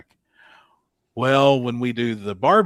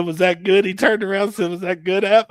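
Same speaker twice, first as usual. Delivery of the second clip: eager announcer; 9 semitones higher, 6 dB louder than usual. Retold or imitated. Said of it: "he said".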